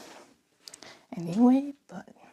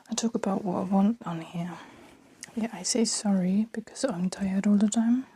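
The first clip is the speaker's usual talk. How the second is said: whispering. Real, not acted. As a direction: keep it hushed, mother in the next room. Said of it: "I don't know why I'm whispering so much".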